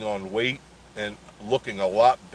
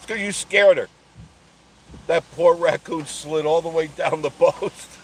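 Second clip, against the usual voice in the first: laughing loudly, pitched up; cracking up while telling it; 6 semitones higher, 6 dB louder than usual. Real, not acted. Laughing with a friend about the raccoon's slide.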